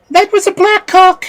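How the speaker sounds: put-on high, loud girl's voice; girl answering aloud. A narrator's reading. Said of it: "Prunella answered".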